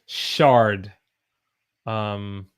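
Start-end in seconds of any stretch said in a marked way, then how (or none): 0.0-0.9 "Shard" with a strong American R; hard R. Shown as a demonstration. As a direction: lean on the R in "Shard". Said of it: "very hard R's".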